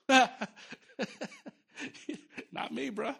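laughing it off, not me; laughing refusal. Real, not acted. Preacher laughing off God's call to plant a church, amused. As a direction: chuckle through the refusal. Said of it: "laughing refusal of calling".

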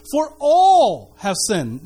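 "all" drawn out slowly, heavy; stress on all. Shown as a demonstration. Pastor quoting scripture to congregation, slow heavy stress. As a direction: lean hard on "all". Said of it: "The key word there is all".

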